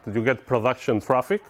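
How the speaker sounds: normal talking voice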